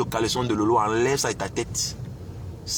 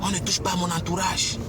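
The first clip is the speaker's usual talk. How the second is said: taunting tirade; 6 semitones higher than usual. Real, not acted. Taunting rival to defend his witches.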